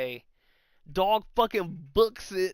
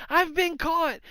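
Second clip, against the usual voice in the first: put-on caught kid; 10 semitones higher, slower than usual.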